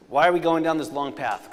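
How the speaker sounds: ordinary delivery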